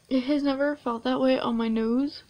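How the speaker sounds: talking while peeling face mask; fingers at lips. Real, not acted. Seen 0: fingers touch upper lip and lips, briefly away near 1.2s.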